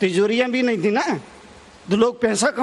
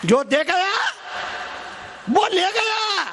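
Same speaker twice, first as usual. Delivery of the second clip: high-pitched ringing declamation; calling out; 8 semitones higher than usual. Real, not acted. Preacher praising giving to the congregation, calling out. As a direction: lift the saying high for all to hear. Same saying called out high.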